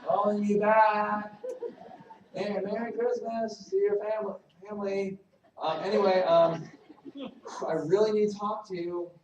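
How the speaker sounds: a needy caller who loses it and ends up shouting; the worst voicemail, from cheery to fed-up shouting; frustrated voicemail building to a shout. Acted. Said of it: "here's the worst voicemail".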